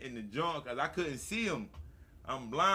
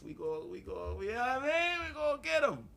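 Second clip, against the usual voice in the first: building hype; 8 semitones higher than usual. Retold or imitated. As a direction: psych up the crew, climbing higher.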